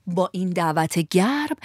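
ordinary delivery

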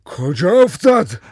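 loud, high, drawn-out demand; shout. A narrator's reading. Father shouting where his son fell, loud and urgent. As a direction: shout the question, stretching it out. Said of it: "بابای حسنی داد زد".

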